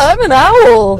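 high, loud, drawn-out hoot-like voice; mock owl. Co-host joins the curious-caller bit as an owl, high drawn-out hooting.

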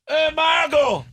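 loud, high holler; shouted commentator parody; JBL impression. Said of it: "That's exactly how he sounds".

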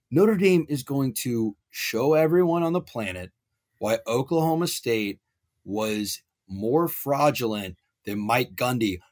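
normal talking voice

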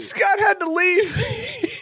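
high, slow, cracking with laughter; laughing. Real, not acted. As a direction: squeak it out through helpless laughter. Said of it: "repeated line, second laughing".

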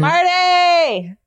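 high, loud cheery call. Pretend. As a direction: sing out the name like greeting a pal. Calling out the listener's name.

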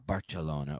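Barcelona softly, with the c changed; local Barcelona pronunciation. Shown as a demonstration. Trying out the local way, Barcelona with changed c. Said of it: "you either pronounce it".

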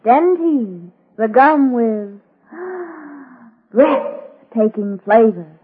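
drawn-out slogan with a dramatic gasp; slow ad slogan. Acted. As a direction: say it at half speed, gasping before breathtaking. Repeated slogan of gum advert.

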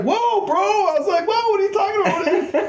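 very high, innocent whoa; imitating himself, high and bewildered; mock startled. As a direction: play the baffled innocent, hammed-up. His own startled reply, retold.

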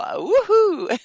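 high, loud whoop of delight; gleeful whoop. Real, not acted. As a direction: let out a high, celebratory cheer. Cheer after promising even more.

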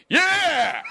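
high, loud lone whoop; screaming cheer. Retold or imitated. Lone man cheering an unpopular name, screaming. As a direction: yell it from the back of the theater. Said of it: "voice screaming from the back".

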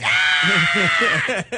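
put-on high, loud child's shriek; mock horror scream; imitated terrified scream. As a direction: scream like a kid scared by a clown.

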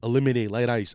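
very low put-on rapper voice. Shown as a demonstration. Customer adding a drink to his order, in a deep voice. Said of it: "I turn into [name]".

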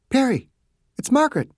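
doctor approaching an injured man, gentle; gentle Margaret. A narrator's reading.

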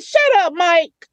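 high-pitched playful brush-off; playful teasing. Retold or imitated. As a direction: tease him like an old friend. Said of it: "I'm just this down-to-earth sister".

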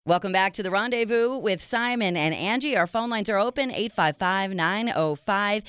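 upbeat announcer read of the number; radio host reads the number to listeners. Acted.

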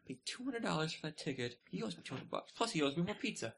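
usual speaking voice

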